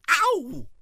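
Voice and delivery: loud high yelp of pain. Acted. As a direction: yelp as he bumps. Bumped his head.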